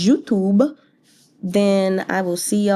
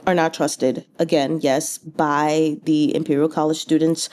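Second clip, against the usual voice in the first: ordinary talk, nothing marked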